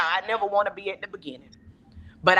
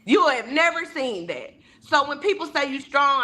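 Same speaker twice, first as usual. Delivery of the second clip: usual speaking voice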